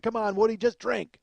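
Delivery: rowdy friends pushing a drink; urging shout. Retold or imitated. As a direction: egg him on to drink. Friends urging drunk Woody.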